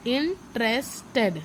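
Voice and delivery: "interested" broken slowly into in, tres, ted; slow teacher's breakdown of "interested", pausing between syllables. Shown as a demonstration. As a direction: sound out "interested" in three separate, drawn-out chunks. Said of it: "कैसे बोलते हैं".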